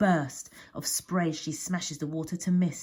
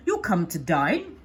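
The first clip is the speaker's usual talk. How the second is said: loud, slow gentleman's offer; jovial gent; 7 dB louder than usual. A narrator's reading. Gent inviting Nessie to dinner, jovial. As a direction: offer it loudly and slowly. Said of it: "offers that gent with a laugh".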